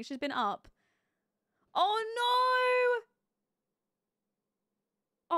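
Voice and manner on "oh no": high, loud dismayed cry; shocked oh no. Real, not acted. Gamer shocked at her sim's ultimatum, loud dismayed oh no. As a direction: gasp it out in dismay. Sim hits him with ultimatum.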